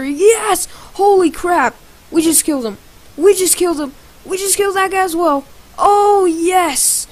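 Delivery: real gamer hype; excited celebration. Real, not acted. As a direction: cheer each kill in a thrilled rush. Celebrating kills in a game.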